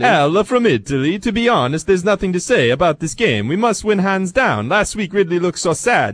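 hammed-up sing-song Italian accent, pitched high. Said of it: "should I try to read this in the accent".

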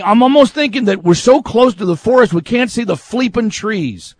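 usual speaking voice

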